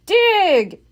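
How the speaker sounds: Captain Bones shouting at the diggers; shouted. A narrator's reading. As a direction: bark it at the crew. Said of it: "shouted Captain Bones".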